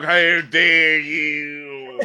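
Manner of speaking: very slow, drawn-out mock offense; mock outrage. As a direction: stretch it out, hammed-up offended. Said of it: "It's like".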